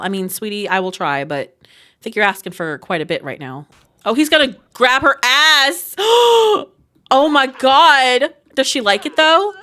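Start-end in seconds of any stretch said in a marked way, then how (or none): 6.0-6.6 sharp high gasp; a loud scandalised intake of breath; real startled gasp; shocked gasp. Real, not acted. Reacts to him grabbing her ass.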